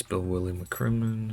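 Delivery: normal talking voice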